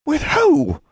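very high, loud shocked question; high-pitched appalled outburst. A narrator's reading. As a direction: blurt it out, scandalised. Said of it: "added in a shocked voice".

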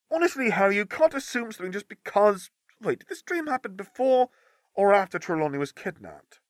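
a hint of a sceptical girl; lightly voiced doubtful girl; lightly voiced teen girl. Hermione's voice, skeptical.

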